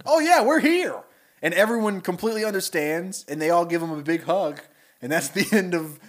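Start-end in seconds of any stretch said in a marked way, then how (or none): 0.1-1.0 high, loud cheery mob reply. Retold or imitated. Supposedly killed friends revealing themselves, high loud cheery reply. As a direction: chirp it out like the not-dead crowd. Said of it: "they're like".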